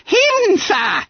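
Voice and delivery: very high squeak; frightened schoolboy squeal; babbling with terror. A narrator's reading. Terrified pupil answering his schoolmaster, squeaking. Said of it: "He was fairly babbling with terror".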